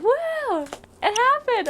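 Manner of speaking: very high, thin cheer; celebratory squeal. Retold or imitated. Cheers right after getting engaged.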